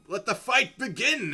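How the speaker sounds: high, loud announcer shout; Mordecai. Acted. Arena announcer starts the fight, loud and high. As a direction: launch the bout with a projected cry. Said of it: "Mordecai announces".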